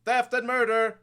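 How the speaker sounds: high, loud street-corner cry of a newspaper hawker; town crier. Acted. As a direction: belt out the headlines to the whole street. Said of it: "sort of go, like, you know".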